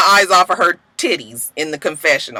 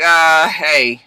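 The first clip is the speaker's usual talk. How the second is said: low, reluctant mock hello; unimpressed greeting; 4 semitones lower than usual. Everybody looking at the new girl, unimpressed. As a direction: say hello with no enthusiasm.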